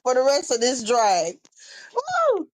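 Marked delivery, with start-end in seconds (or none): 2.0-2.5 high whoop. Real, not acted. Playful whoop.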